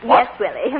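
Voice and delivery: usual speaking voice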